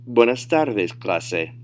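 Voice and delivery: fluent, connected "Buenas tardes, clase"; advanced-level demo without stretching; natural speed. Shown as a demonstration. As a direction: say it in one easy run at native pace. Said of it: "say them at natural speed".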